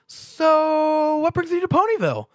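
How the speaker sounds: very high, loud bubbly pony voice; Pinkie Pie. A narrator's reading. Pinkie Pie greeting Stuart with a loud high squeal. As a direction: squeal the welcome like an overexcited pony. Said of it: "full of excitement".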